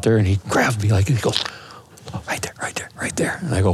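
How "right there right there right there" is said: breathy unvoiced whisper, barely audible; urgent whisper. Hunting partner pointing out the bull, urgent whisper. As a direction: hiss it so the elk won't hear.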